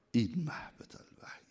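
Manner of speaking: hushed whispered repeat. Acted. Preacher whispering the phrase to the congregation. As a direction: breathe it as a secret.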